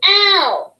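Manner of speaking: "Ow" said as a hurt cry, the ou sound. Shown as a demonstration. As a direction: model the ow sound for the kids. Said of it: "O U and O W say".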